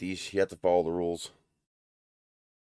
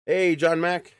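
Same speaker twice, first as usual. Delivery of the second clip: higher, louder cheery hello; calling out greeting; 7 semitones higher, 10 dB louder, much slower than usual. Real, not acted. Streamer greets a viewer joining the stream, loud cheerful call. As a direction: call the name out brightly.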